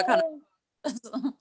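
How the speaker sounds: gravelly dog-talk voice. Acted. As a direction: put on the voice he uses for his dog. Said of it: "when I talk about her, I start talking like this".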